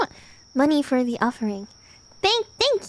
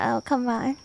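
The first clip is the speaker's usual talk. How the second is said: ordinary talk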